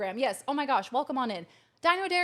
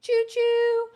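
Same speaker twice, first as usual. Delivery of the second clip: high, loud train hoot; train-whistle call; 12 semitones higher, 8 dB louder than usual. Pretend.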